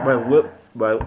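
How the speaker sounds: talking through a quivering lip. Real, not acted. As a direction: lips trembling from the burn. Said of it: "my lip is quivering".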